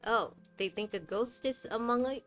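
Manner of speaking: normal talking voice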